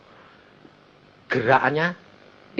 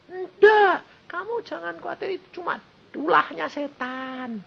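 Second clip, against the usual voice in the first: reassuring; 5 dB quieter than usual. Retold or imitated. Pastor reassures doctor, voice breaking.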